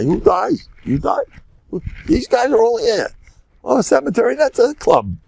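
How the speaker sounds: high, incredulous mocking questions; mock disbelief. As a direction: scoff at the idea that anyone dies. Mocking the fear of death.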